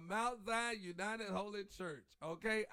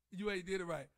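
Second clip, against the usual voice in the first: usual speaking voice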